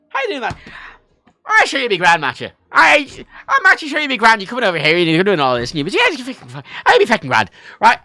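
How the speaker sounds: high, lively Irish reassurance; casual Irish. Retold or imitated. Irish friend saying he'll be grand, casual Irish. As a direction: imitate a chummy Irish welcome. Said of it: "It's casual".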